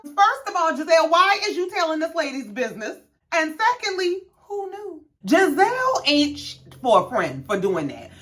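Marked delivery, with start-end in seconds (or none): 0.0-6.6 high-pitched, scandalized scolding; indignant. Real, not acted. Viewer scolding Giselle for telling this lady's business, indignant. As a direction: take her to task, pitch way up.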